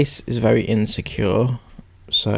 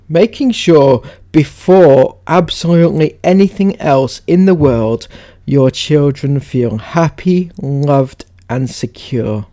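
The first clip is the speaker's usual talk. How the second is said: loud, higher reading broken by laughs; read-aloud; 4 semitones higher, 7 dB louder than usual. Acted. Reading Sarah's post on parenting, emphatic read-aloud.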